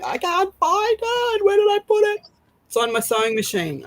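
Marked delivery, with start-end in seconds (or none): 0.0-2.2 much higher, flustered mock panic; hammed-up frantic searching voice; mock panicked self. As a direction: play your future confused self in a fluster. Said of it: "if I come in here going".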